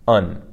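"un" between E and English un; pinyin EN sound. Shown as a demonstration. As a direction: say "un" with a light, half-swallowed N. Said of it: "Kinda like U N".

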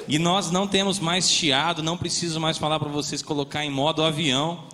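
normal talking voice

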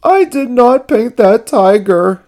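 high, loud, upset confession; high character voice in mock tears; tearful cry. A narrator's reading. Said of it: "he cried".

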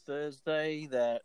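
normal talking voice

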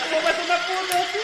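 high, loud yell; app shout. Retold or imitated. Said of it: "Y dice".

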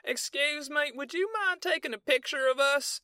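very high-pitched mock girly request; squeaky put-on female voice; girl's voice. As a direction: imitate a woman asking a stranger for a favour. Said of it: "the girl comes up to me and she's like".

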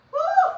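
very high, loud "woo"; high whoop. Real, not acted. Preacher feeling it strong in the spirit, high whoop. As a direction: let out a piercing whoop.

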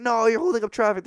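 high, scolding driver; mock yelling. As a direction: imitate one side of a road argument. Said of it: "they're just yelling at each other".

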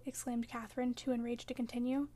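ordinary delivery

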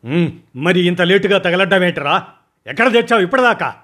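high, loud, fast impatient shout; impatient yelling. Acted. Boss yells impatiently at his late man, high and fast. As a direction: bark at him for being late. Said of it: "అరిచాను అసహనంగా".

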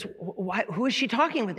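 high-pitched, stammering salesman; puzzled salesman. Acted. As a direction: push the question up high, baffled. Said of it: "there's a salesman on there".

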